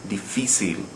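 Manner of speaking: diFÍcil, weight on FÍ; second-to-last syllable stress. Shown as a demonstration. Teacher explaining the broken rule, difícil stressed on FÍ. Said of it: "We're stressing the second to last syllable".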